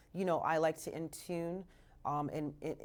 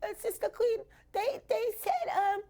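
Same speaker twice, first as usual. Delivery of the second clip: put-on much higher, hesitant little girl; high, timid child's complaint to her mother; 14 semitones higher than usual. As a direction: sound like a nervous kid telling mom. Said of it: "I'm like".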